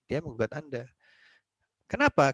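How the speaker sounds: ordinary talk, nothing marked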